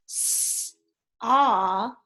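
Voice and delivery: S then aw, sounded apart; sounding out. Shown as a demonstration. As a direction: blend s and aw slowly. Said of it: "brought down s in front of aw".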